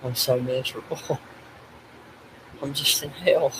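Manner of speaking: real soft, choked despair; on the verge of crying; tearful misery. Real, not acted. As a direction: let the words break into a quiet sob. Grieving man breaks down while confessing misery.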